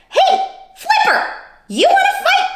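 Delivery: high, squeaky challenge; grouchy ladybug. Acted. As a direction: pick a fight in a tiny voice.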